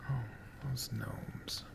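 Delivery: plain reading voice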